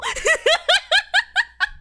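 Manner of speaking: long high giggle; high, trailing cackle; laugh. Real, not acted. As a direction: giggle helplessly at your own joke. Laughing at own bit.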